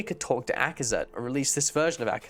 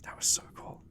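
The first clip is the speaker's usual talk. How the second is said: whisper; 8 dB quieter than usual. Real, not acted. Hushed awe after scene.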